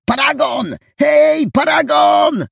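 high-pitched shout across water; urgent, high hailing shout; loud calling. A narrator's reading. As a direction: call out loud to a friend far off. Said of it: "usłyszał głośne wołanie".

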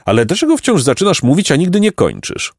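inquisitive pompous donkey. A narrator's reading. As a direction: ask with self-important curiosity.

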